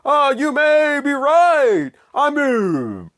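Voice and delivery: high, drawn-out mock singing that slides downward; malfunctioning animatronic singer. Reviewer recalls pizza-stage characters malfunctioning, slurred mock singing. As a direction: imitate a stage robot breaking down mid-song. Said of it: "they malfunction, and they go".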